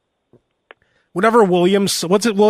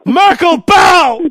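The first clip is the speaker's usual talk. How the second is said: commanding shout; 9 semitones higher, 7 dB louder, much slower than usual. Pretend. Royal commands a bow.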